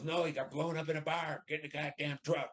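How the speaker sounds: high, angry bark of a furious sergeant; retold angry yelling. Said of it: "he just yelled and screamed at me".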